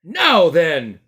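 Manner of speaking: loud stern constable; firm address; policeman. Acted. Policeman addresses Uncle Andrew.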